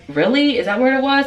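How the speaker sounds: high, wide-eyed questioning; acted surprise. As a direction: play innocent and amazed. Acts surprised to bank.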